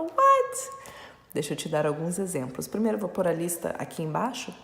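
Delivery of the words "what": sudden high squeak of disbelief; mock surprise. Teacher mocking a student's startled reaction, high and loud. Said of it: "Você fala".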